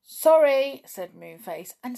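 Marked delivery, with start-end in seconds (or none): 0.1-0.8 loud, high, dismissive call; curt storybook brush-off, pitched up; brusque refusal. A narrator's reading. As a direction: toss it out before shutting the door. Moonface refuses and slams door.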